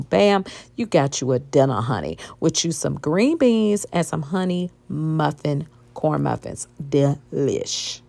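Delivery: loud, upbeat recipe demo voice; walk viewers through the recipe like a TV cook; cooking show host. Acted. Cooking recipe segment, louder.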